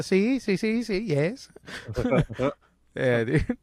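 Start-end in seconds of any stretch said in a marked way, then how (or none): 0.0-1.5 put-on higher, rapid-fire Spanish-accented yeses; Private Garcia impression. Private answering roll call, higher mock Spanish accent. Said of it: "Private First Class Garcia".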